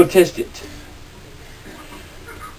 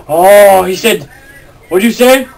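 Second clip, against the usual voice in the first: usual speaking voice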